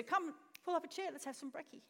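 soft, easy invitation falling away; casual welcome. Acted. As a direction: invite them over with no reproach. Actual Jesus against the angry one.

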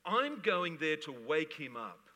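slow, firm declaration; Jesus speaking. Retold or imitated. Jesus declaring he will wake Lazarus, slow and certain. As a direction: announce it with calm certainty. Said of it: "He's hearing the Father".

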